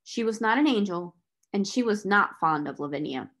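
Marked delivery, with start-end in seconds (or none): none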